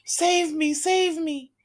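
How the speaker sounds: high, loud desperate plea; screaming in terror. Acted. Girl in a nightmare screaming for help. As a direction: cry out for rescue from the nightmare. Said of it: "She screams and screams".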